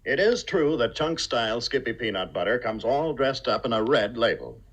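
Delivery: ordinary talk, nothing marked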